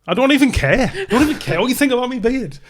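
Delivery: high-pitched indignant protest. Real, not acted. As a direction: protest too much, pitch shooting up. Hits back at beard critic.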